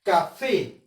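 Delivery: ordinary talk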